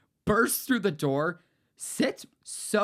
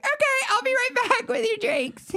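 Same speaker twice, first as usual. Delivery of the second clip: much higher, chirpy waiter brush-off; fake customer-service voice; 4 dB louder than usual. Waiter brushing off creepy drunk customers, sugary high voice. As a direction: sing it out sweetly to escape the table.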